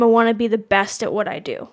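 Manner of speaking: normal talking voice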